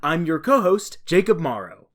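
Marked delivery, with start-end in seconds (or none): none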